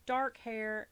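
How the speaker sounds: ordinary talk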